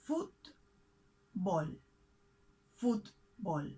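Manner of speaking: Fútbol in two separated syllables, stress on Fút; split into syllables. Shown as a demonstration. Teacher showing learners the accent, Fútbol split with first syllable stressed. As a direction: say Fútbol slowly, pausing between Fút and bol. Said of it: "We separate this word in two syllables, and we mark the accent on the first one".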